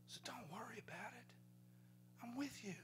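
whispered reassurance; whispered comfort to a scared son. Retold or imitated. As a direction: soothe a child in a whisper. Father soothing son at night.

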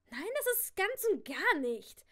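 loud, high protest; heated contradiction. Acted. Niece shakes off her uncle's grip, protesting loudly. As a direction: refute him with full emphasis.

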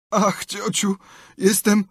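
high, pitiful groan; feigned suffering. A narrator's reading. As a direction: moan to aunt as if dying. Tomek feigns agony to aunt.

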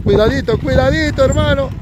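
warning climbing high and drawn tight; urgent warning. Real, not acted. Commentator warning a brother rider on the trail, urgent. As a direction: call out with pitch rising on each repeat.

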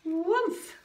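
loud, high "woof" for a falling tree; whoosh sound effect. A narrator's reading. Storyteller as the tree comes down, loud sound effect. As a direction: voice the crash as a sudden burst.

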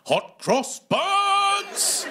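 high, loud, very drawn-out cheer; mock overexcited cry. Comic as dude answering who's pumped for Easter, ecstatic yell. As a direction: stretch it out like an ecstatic fan. Acts an overexcited audience member.

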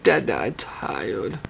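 low, slow, weary imitation; quoting a tired child, softly and slowly; imitating tired child. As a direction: drag it out like a sleepy toddler. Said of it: "you said".

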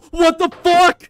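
gasp, then a high, drawn-out yell; shocked scream. Real, not acted. Gamer cut off by a sudden fall, shocked scream. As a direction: scream it in disbelief, very loud.

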